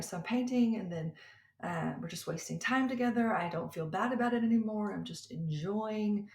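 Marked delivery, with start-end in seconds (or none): none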